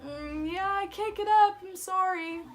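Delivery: straining to say she can't get up; strained apology. Retold or imitated. Retold pained apology to teacher.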